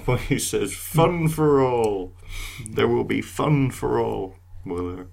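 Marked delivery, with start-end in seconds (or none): 0.7-4.3 slow robotic announcer voice. Pretend. Quoting the controller's lines.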